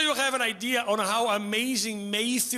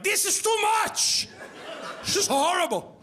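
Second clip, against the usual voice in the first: complaining locals; 11 semitones higher than usual. Retold or imitated. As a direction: imitate locals complaining dramatically. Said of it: "they're like".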